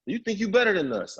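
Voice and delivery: high-pitched, offended challenge; acted-out resentful questioning; indignant friend. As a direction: call them out, incredulous. Friend resents his leaving.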